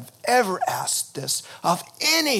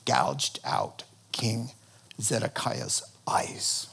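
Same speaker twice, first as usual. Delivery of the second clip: much lower and softer, slow, almost whispered; hushed; 5 semitones lower, 7 dB quieter, slower than usual. A narrator's reading. Pastor reaching the blinding, dropped to a whisper. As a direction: let the horror drop to a breath. Grim climax of the story.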